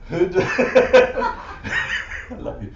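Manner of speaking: slow, loud words broken by laughter; laughing through it. Real, not acted. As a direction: let the laugh swallow the words.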